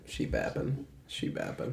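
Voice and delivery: whispering; whispered repeat. Acted. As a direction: breathe it out as a whisper. Repeats slang softer.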